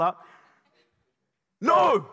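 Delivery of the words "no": sudden loud high no; booming rebuke from the pulpit; shouted no. Real, not acted. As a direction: cut them off with a shout. Preacher erupts in rebuttal.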